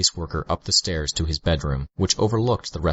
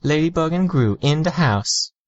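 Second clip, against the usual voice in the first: mock shout; 11 semitones higher than usual. A narrator's reading. Teen girl mock-announces the narrator, a playful hushed shout. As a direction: pretend to shout, barely above talking. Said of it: "she mock shouted at a volume barely above regular speech".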